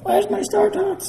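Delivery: high, whiny demand; whiny fan voice. Retold or imitated. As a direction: squeak it like a pushy fan. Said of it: "people are like".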